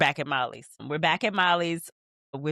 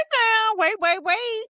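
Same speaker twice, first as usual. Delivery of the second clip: put-on high, loud girly squeal; excited squeal; 6 dB louder, slower than usual. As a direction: squeal like she can't hold it in. Voicing Issa squealing excitedly.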